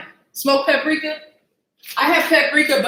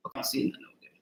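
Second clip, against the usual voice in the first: whisper; 14 dB quieter, much faster than usual. Real, not acted. Searching the room quietly.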